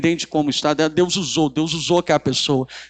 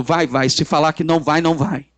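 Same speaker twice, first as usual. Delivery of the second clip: ordinary delivery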